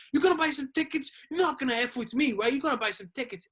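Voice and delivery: pushy ticket seller, rattled off at speed; retold yelling. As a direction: imitate a man hollering at customers. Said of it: "He's yelling at people".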